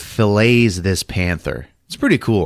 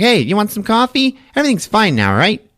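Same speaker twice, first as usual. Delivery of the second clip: high-pitched imitation of a woman; lightly mocking high female voice; woman's chirpy offer; 12 semitones higher than usual.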